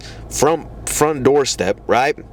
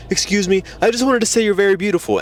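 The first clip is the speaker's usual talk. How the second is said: rushed, higher-pitched compliment; imitating a hurried, nervous approach; mock weak approach; 7 semitones higher, faster than usual. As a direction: blurt it out before she is listening. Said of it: "so it comes off weaker".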